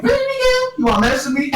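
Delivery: high-pitched squeaky challenge; mock challenge. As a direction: squeak it up high, picking a fight. Acts out quarrel in retold movie.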